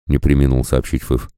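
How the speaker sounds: usual speaking voice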